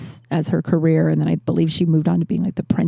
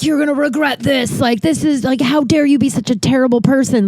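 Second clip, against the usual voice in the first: higher-pitched imitation of an outraged relative; threatening; 6 semitones higher than usual. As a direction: scold in mock threat. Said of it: "but, like, threatening".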